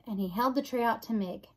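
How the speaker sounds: plain reading voice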